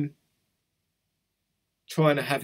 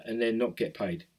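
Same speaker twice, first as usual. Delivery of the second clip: usual speaking voice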